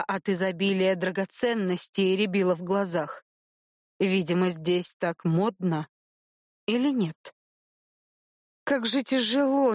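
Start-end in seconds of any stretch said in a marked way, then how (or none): none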